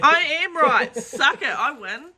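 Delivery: gloating. Real, not acted. Gloating after winning the bet.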